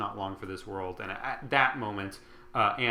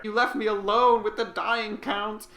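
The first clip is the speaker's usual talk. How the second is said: high, louder, slow wail; milk the outrage, voice way up; dramatic whine; 10 semitones higher, 5 dB louder than usual. Retold or imitated. Cousin complains dramatically.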